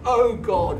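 sudden high shout of Oh God; loud, high, startled cry. Retold or imitated. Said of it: "and I shouted".

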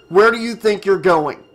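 sharp challenge. A narrator's reading. Nick shot upright as Melissa heads out, challenging. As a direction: challenge them loudly.

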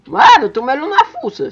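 high gleeful jeer; excited taunt. Real, not acted. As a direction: taunt him with glee.

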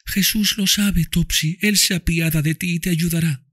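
put-on high child voice, tender; dying girl speaks lovingly to Tom and Mami, high child voice; loving little girl. A narrator's reading. Eva comforts Topsy.

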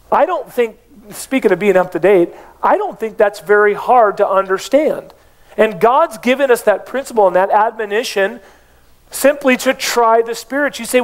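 ordinary talk

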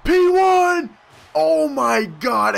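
very high, loud yell; excited shout. Real, not acted. Player yelling at a risky move, astonished shout. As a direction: belt it out, stretching the last words.

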